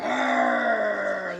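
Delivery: short, loud playful bear growl. Acted. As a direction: growl like a grumpy old bear. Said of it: "the old bear growled".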